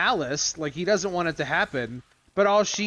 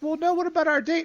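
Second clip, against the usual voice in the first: put-on much higher, pleading girlish whine; mock whiny girl; 12 semitones higher than usual. Granddaughter pleads with Gambit about their date, high and whiny. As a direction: play her clinging to the date idea.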